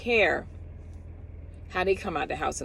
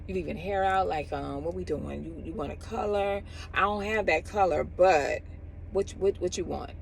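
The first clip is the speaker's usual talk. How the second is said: imitating a careless stylist; 7 dB quieter than usual. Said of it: "And she's like".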